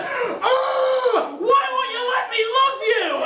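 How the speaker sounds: very high, desperate mock scream; intense true self. True me messaging a girl, hammed-up desperate scream. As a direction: unleash it like a bull out of its cage. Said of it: "the true me wanted to send a message".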